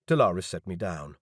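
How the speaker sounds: ordinary delivery, nothing marked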